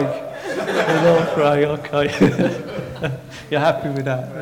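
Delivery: drawn out and laughing; mock scold through laughter; slow laughing tease. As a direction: stretch it out mockingly while laughing. Teasing crowd slower.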